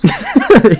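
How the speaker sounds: high short laugh; loud laugh. Real, not acted. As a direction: burst out laughing.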